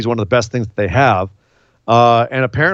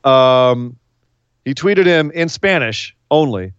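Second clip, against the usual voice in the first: ordinary delivery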